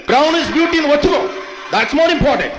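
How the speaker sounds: high, drawn-out insistence; emphatic urging. Real, not acted. Speaker urging the audience to accept their skin colour, emphatic. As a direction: press it home with pitch lifted high.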